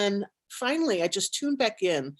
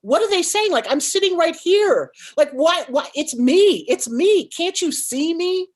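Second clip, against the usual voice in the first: fight or flight squeal; 8 semitones higher, 7 dB louder than usual. Retold or imitated. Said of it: "and I'm like".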